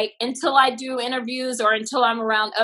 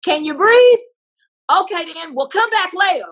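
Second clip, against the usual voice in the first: mock hospital brush-off; 7 semitones higher, 6 dB louder than usual. Hospitals brushing off covid callers.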